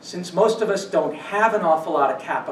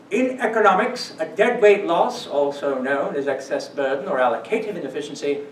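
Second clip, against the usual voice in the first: hammed-up British accent reading an encyclopedia; mock British accent for a dry definition; horrendous British accent. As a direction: quote it in a bad British accent. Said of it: "To quote Wikipedia again in that horrendous British accent".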